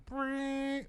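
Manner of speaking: high imitated doorbell ring; imitated buzzer. Imitating the magistrate's buzzer. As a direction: make the ring of the button. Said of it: "leans on the button".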